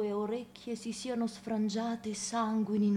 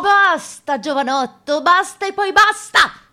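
roaring at him to stop, much higher and louder; furious shout; 8 semitones higher, 18 dB louder than usual. Acted. Old priest shouting down the young man, furious. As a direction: bellow basta as a command.

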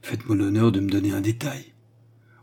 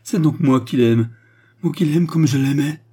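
loud, higher-pitched elated outburst; rapturous declaration; transported with joy; 5 semitones higher, 6 dB louder than usual. A narrator's reading. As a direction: exult in being loved back. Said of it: "dit André, folle et transportée".

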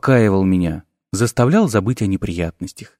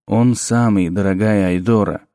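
sinister professor; 4 semitones lower than usual. A narrator's reading. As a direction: purr it low like a dark sorcerer. Necromancer professor speaks low.

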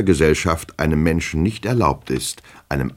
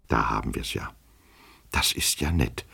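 hushed, much softer murmur; whispering to himself; 5 semitones lower, 8 dB quieter than usual. A narrator's reading. Stunned hero left alone, whispering to himself. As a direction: say it under your breath to yourself. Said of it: "flüsterte unser Held".

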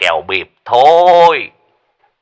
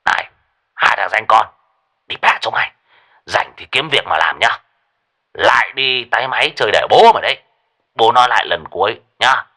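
cursing rant; 2 semitones higher than usual. Acted. Lão Lý cursing both friends on phone, building anger. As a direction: curse them out and hang up. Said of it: "văng tục, chửi hai thằng".

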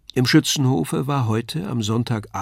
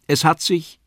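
Wagner's voice; 5 semitones higher, 3 dB louder than usual. A narrator's reading. Wagner reports to Faust.

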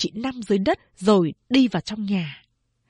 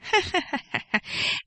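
soft, high eerie breathy laugh; chilling laugh; 10 semitones higher, 5 dB quieter than usual. A narrator's reading. Eldest sister Lai Đệ's chilling laugh from the darkness. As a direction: laugh coldly from the dark. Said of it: "tiếng cười ... nghe ớn lạnh xương sống".

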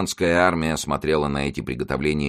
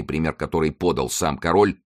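ordinary delivery

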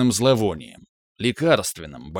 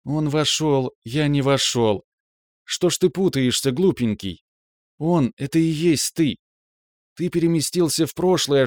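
put-on raised, gentle girlfriend voice; young woman, tender and earnest; 5 semitones higher than usual. A narrator's reading. Girlfriend comforting her upset man, tenderly.

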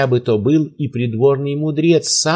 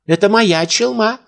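high, raised insistence; insisting old man; 9 semitones higher than usual. A narrator's reading. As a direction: push the claim, voice high.

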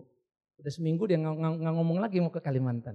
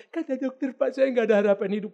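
hammed-up tearful complaint, getting louder; mock crying; 4 semitones higher, 6 dB louder than usual. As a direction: imitate a weepy patient reporting bad news. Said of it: "Ngapain kau nangis?". (pura-pura menangis mengadu)

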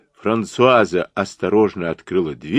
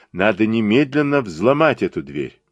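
usual speaking voice

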